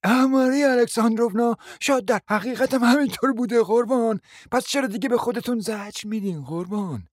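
a hint of smug, falsely sweet lady; lightly voiced sanctimonious murmur; self-righteous tone. Guest needling the hostess with smug sympathy. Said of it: "صدای عادی و حق به جانبی گفت".

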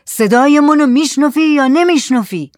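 high, loud insistent question; shouted demand. Acted. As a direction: shout it, pressing for an answer. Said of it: "زاهد داد زد". (داد زدن با اصرار)

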